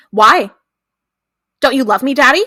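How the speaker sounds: mock childish challenge, pushed out loud; demanding little girl. Voicing girl's menacing challenge.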